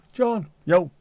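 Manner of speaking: mock rapper shout-out; playful call. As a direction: hail John like a rapper. Calling out with rapper greeting.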